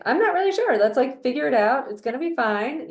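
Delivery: high, loud, unsure reassurance; put-on high voice, brushing off the worry; acted breezy uncertainty. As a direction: pitch it up, playing unsure but reassuring. Models a lighter alternative delivery.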